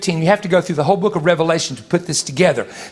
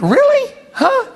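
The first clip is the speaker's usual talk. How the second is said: very high, stunned Really and Huh; amazed squeal; 3 dB louder, slower than usual. Retold or imitated. Imagining the dead thinking of him, in high amazed disbelief. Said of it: "I'm thinking to myself".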